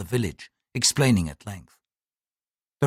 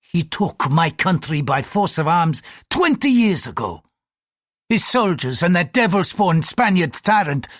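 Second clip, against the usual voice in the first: heated, high-pitched grievance; bitter indignation; 7 semitones higher, 3 dB louder than usual. Acted. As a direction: pour out a patriot's anger. Priest rages about conquered country.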